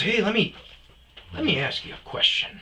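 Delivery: loud, high-pitched imitation of the professor; retold tipsy customer. Professor accosting his student bartender, loud mock voice. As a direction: pitch the question up and push it out loud.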